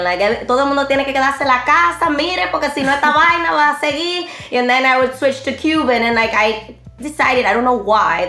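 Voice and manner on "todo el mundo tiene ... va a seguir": quick, higher Dominican Spanish mom voice; Dominican accent. Shown as a demonstration. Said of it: "in Dominican".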